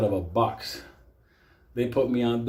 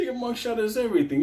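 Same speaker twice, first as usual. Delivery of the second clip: laughing friend repeats the vain line; laughing while quoting; 12 semitones higher than usual. Retold or imitated. Benito's mugshot advice retold while laughing. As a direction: quote it through laughter. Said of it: "He said".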